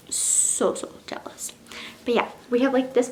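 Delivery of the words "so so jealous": breathless gushing whisper. Real, not acted. Swooning over the jealous hero, whispering softly. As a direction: whisper it like a delicious secret.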